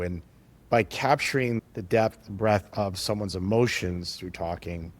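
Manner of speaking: ordinary delivery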